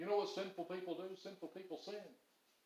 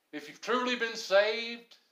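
emphatic preaching; 8 semitones higher, 8 dB louder, slower than usual. Real, not acted. Preacher's emphatic high point.

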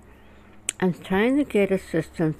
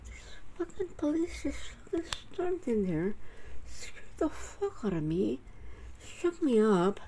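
softer, half-whispered rant; shaken whisper; 5 semitones higher, 7 dB quieter than usual. Retold or imitated. Calling her friends about the police, a softer shaken whisper. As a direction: hush it like you're still rattled.

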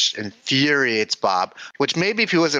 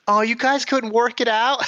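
imitating Linda; 13 semitones higher than usual. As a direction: chirp it up high like Linda. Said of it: "my favorite line of the whole episode".

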